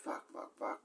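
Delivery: whispered panicked curse. Retold or imitated. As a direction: whisper it in shock over the body. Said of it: "saw Greenie going like this".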